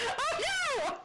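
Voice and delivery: ordinary talk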